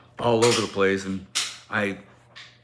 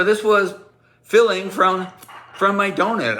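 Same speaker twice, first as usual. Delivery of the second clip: raised, loud reveal; mock announcement; 8 semitones higher, 4 dB louder than usual. Teller showing class the donut trick, loud high reveal. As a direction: proclaim it high and loud to the room.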